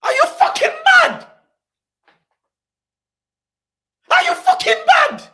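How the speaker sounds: very high, loud, fast angry screech; high-pitched furious outburst; outraged yelling. Real, not acted. Outraged at an advert.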